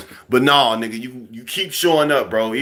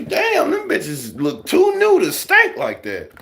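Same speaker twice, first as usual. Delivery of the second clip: mock shock; slower than usual. Mock shock at new shoes.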